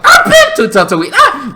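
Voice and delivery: hammed-up braggart voice; mock Tatooine guy. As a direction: blurt it out like a bragging local with a laugh. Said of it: "there is a guy on Tatooine that's like".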